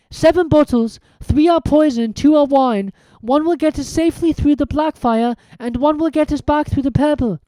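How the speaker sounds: high, fast, eager reasoning; brisk Hermione. A narrator's reading. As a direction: count it off like a clever girl. Hermione working the puzzle.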